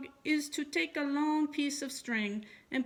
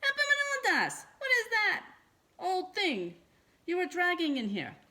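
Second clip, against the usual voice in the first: much higher, louder shrieking mother; a horrified mother shrieking at the dusty bread; little scream; 11 semitones higher, 6 dB louder than usual. A narrator's reading. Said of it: "let out a little scream".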